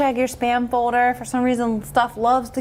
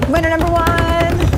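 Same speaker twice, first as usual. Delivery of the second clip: slow, loud and higher, savoring it; drawn-out winner announcement; 8 semitones higher, 5 dB louder than usual. Real, not acted. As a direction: announce it like a game show host.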